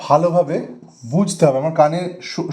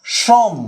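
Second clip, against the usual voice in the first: "সম" higher, closed on the m; isolated closed syllable; 6 semitones higher than usual. Shown as a demonstration. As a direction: say "সম" alone and stop. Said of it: "সেটা সম বলার পর কিন্তু আর continue হচ্ছে না". ("সম" আলাদা করে, চড়া সুরে, ম-তে থেমে)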